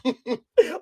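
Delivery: a high laugh at his own bad accent; real laugh, pitched high; high burst of laughter. Real, not acted. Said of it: "Worst accent ever".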